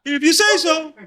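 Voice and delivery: high, loud dopey reply; high silly voice. Retold or imitated. The recruit agrees with the gang members in a high, dopey voice. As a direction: answer like a clueless kid. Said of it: "the recruit replies".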